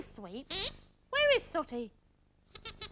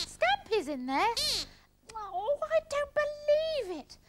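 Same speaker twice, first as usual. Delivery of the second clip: drawn-out, high-pitched disbelief; exasperated; 7 semitones higher, slower than usual. Acted. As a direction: groan it out slowly in exasperation. Exasperated at waiting for bathroom.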